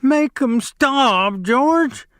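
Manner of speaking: very high, slow wailing plea; terrified cry. Acted. Lennie covering his face, begging George in a high slow wail. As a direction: bleat it in terror. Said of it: "He cried".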